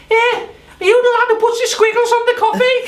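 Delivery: high, loud mocking cook's voice; kitchen guy voice. Cook asking the barista about squiggles, high and mocking. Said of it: "and he goes".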